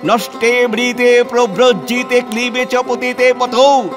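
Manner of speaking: high, exultant declaiming; jubilant discovery. Acted. Reformer finds the verse backing widow remarriage, jubilant. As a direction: cry out the find in triumph, pitch held high.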